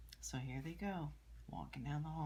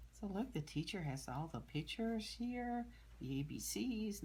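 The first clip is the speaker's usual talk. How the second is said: ordinary delivery, nothing marked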